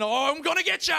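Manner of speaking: high mock-menacing shout; playful growling dad. Dad grabs son for a hug, playful roaring shout. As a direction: roar it like a dad pouncing for a hug. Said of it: "that sound was just the sound of my dad".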